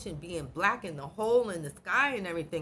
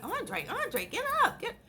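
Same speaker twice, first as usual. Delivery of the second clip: calling out. Retold or imitated. Waking her brother up.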